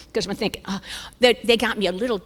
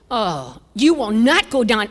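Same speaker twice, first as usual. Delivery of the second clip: louder imitation of a forbidding surgeon; stern order; 4 dB louder, slower than usual. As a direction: lay down the law like a surgeon. Surgeon forbids her in retold scene.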